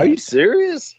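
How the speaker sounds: can't believe what he's hearing; real surprise. Real, not acted. Host reacting to the wrestler's story with real shock. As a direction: pitch leaps high in disbelief.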